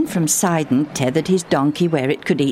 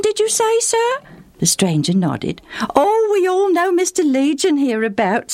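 put-on much higher, bright eager village boy; a cheerful boy helping a traveller; 9 semitones higher than usual. Boy answers the stranger.

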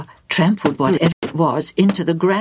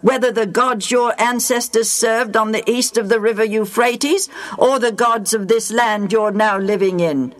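raised, measured voice of a leader addressing the people; Joshua's solemn speech; 6 semitones higher than usual. Acted. Joshua to the Israelites, solemn proclaiming leader. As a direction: proclaim it to the whole nation.

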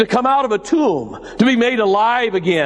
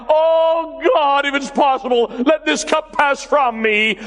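much higher, anguished cry to God; Jesus crying out; 9 semitones higher than usual. Acted. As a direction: plead upward in agony. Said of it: "He cried out".

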